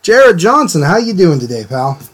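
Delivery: ordinary talk, nothing marked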